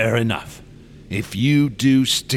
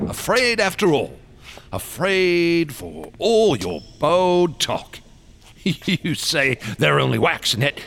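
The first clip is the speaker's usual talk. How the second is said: mocking taunt; 6 semitones higher than usual.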